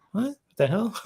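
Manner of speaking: very high-pitched puzzled question; high, incredulous reaction, played for fun; retold bafflement. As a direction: act out a startled double take. Retelling reaction to flying cupids.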